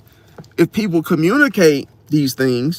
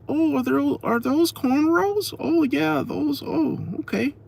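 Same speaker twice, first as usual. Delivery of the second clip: high, soft mother voice; softly put-on high voice, imitating a mother; 11 semitones higher, 6 dB quieter than usual. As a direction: voice her mother, high-pitched and softly curious. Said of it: "her mother was like".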